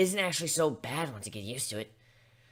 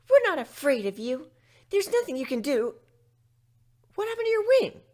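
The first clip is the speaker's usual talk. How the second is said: much higher, louder little filly voice; 13 semitones higher, 7 dB louder than usual. Acted. Young fillies defying the griffin, high and bold. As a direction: play a bold little pony.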